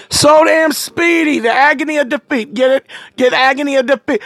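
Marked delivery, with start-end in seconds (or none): 0.1-1.4 drawn-out so damn speedy; slow, smug repeat of so damn speedy; slow emphasis. Acted. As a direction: stretch it out proudly. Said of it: "we're so damn speedy".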